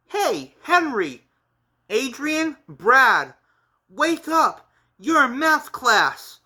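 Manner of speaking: high, insistent calling; waking someone up. Acted. Classmate waking friends in math class, high and insistent. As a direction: call each name up high to rouse them. Character wakes sleepers in class.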